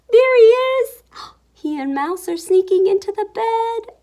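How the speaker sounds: high, loud gleeful reveal; high-pitched storyteller excitement; excited discovery. A narrator's reading. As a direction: gasp and cry it out in delight. Spots gorilla sneaking in.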